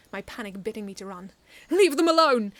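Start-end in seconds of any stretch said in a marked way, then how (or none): 1.7-2.5 very loud, high yell of fear and anger; fearful angry yell. A narrator's reading. Narrator yelling at the drunk men, fearful and angry. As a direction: scream it to protect the kids. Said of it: "I yelled, my voice coated thickly with fear and anger".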